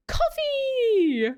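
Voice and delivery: a little niece guessing the coffee, delighted; excited toddler. Retold or imitated. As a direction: blurt it out like a proud three-year-old. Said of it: "and she's like".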